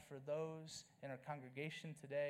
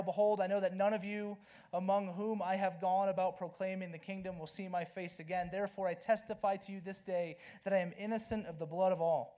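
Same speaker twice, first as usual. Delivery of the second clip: scripture reading; 5 dB louder than usual. Acted. Reads Paul's farewell aloud.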